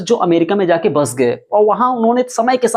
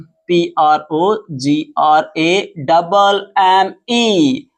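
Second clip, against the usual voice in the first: slow letter-by-letter spelling; much slower than usual. Shown as a demonstration. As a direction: give each letter its own beat. Teacher spells word out letter by letter. (एक-एक अक्षर धीरे-धीरे बोलते हुए)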